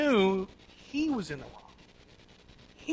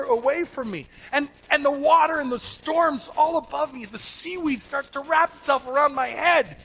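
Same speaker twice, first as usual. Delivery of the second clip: higher, louder, slower, like a man sinking and drowning; dramatic anguish; 8 semitones higher, 7 dB louder than usual. Retold or imitated. Preacher voicing Jonah sinking into the sea, anguished and dramatic. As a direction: pull the listeners down into the water with him. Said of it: "what is Jonah saying happened?".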